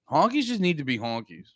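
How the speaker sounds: usual speaking voice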